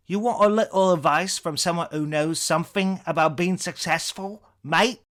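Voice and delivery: angry British rant, building high and loud; ranting English comedian with mounting contempt; Eric Idle. Acted. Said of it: "impression of Idle's Cambridge-tinged inflections".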